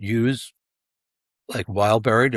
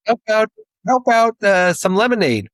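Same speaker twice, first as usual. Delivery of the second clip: put-on helpful kid; 10 semitones higher, 4 dB louder than usual. Imitating his younger self.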